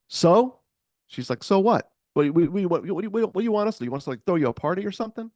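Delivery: high, loud, sneering comeback, speeding up at the end; mock her like a fed-up bar regular; scornful retort. Prostitute snapping at her neighbor.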